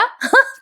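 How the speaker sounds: quick amused titter; real little laugh; short giggle. Real, not acted. Amused at the retold scene.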